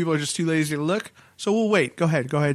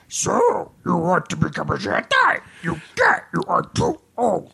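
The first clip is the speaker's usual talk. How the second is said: put-on squeaky old puppet-master voice; Yoda; 2 semitones higher than usual. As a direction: croak it out haltingly, pitched way up. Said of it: "Do the out in the Yoda voice".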